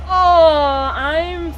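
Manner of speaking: loud high mock cry; fake-sob over the question; a hammed-up wail. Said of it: "How's the German language educational game going?".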